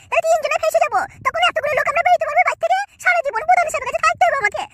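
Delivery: angry wife. Acted. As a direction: nag the husband about his friend. Wife voiced in skit, complaining.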